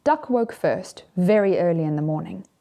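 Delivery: plain reading voice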